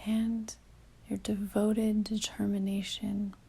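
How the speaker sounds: calm meditation delivery with long pauses; guided meditation. Real, not acted. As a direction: ease the listener inward, leaving long silences between phrases.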